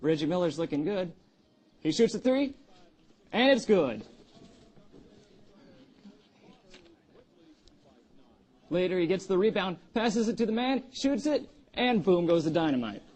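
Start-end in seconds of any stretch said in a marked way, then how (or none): 1.8-13.0 excited play-by-play. Acted. Awkward anchor calls the highlights.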